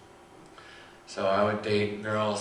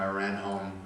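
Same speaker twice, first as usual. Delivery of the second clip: ordinary talk, nothing marked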